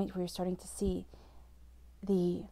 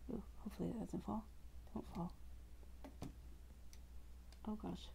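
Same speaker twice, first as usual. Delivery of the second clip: muttered worry; 14 dB quieter than usual. Real, not acted. Card display about to fall.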